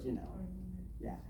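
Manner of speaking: ordinary talk, nothing marked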